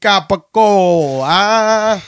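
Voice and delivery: a hint of a Jersey wiseguy; mob accent. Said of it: "in a fucking mob accent".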